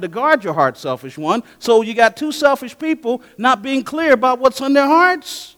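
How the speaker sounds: loud, high, pressing correction; forceful rebuke. Retold or imitated. Pastor rebuking a selfish couple, loud and high. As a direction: drive it home like a scolding counselor.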